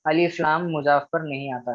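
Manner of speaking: ordinary delivery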